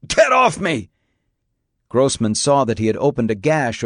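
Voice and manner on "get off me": high-pitched, desperate yell; urgent, raised bark; barked shout. A narrator's reading. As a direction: yell to get him off. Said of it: "he barked".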